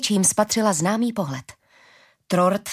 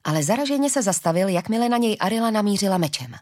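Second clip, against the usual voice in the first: usual speaking voice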